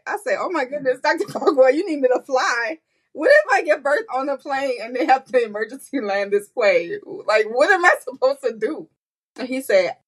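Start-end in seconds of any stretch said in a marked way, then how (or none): 0.4-8.9 higher, lightly frantic pleading; retold panic. Mother retells begging the doctor not to make her fly, mild panic. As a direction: sound alarmed at the idea of flying.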